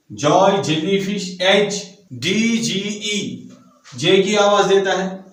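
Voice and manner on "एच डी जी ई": ordinary talk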